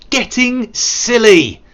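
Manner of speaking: slow, high and loud; hammed-up exasperated cry; mock scolding. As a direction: exclaim it, stretching each word.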